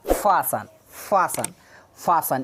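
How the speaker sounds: "फासन" modelled with no T; silent T. Shown as a demonstration. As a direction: repeat "फासन" as separate model words, T left out. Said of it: "क्या पढ़ा जाएगा?". ("फासन" बिना टी के, दोहराकर सिखाते हुए)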